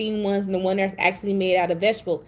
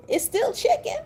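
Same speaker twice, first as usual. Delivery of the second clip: excited squeak; 14 semitones higher than usual. Real, not acted. Excited chicken argument.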